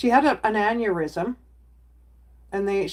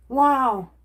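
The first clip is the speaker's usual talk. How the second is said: ordinary talk, nothing marked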